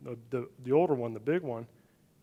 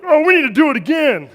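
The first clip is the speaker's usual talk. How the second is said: put-on much higher, louder eager kid voice; eager demand; 12 semitones higher, 16 dB louder than usual. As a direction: demand a rematch right now. Little brother demanding rematch.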